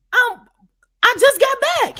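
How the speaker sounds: high, loud exasperated protest. Retold or imitated. Acted phone protest to caller.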